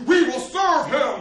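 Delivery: very high, very loud, slow swearing of loyalty; nobles' rallying cry. Retold or imitated. Tearful Hungarian nobles pledge themselves to the baby king, huge shout. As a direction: shout the oath out big and drawn out. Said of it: "They're like".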